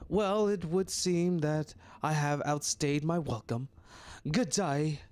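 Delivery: put-on a light, haughty British accent; a stuffy Englishman taking his leave. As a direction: sniff out a prim British farewell. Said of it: "help me with this accent".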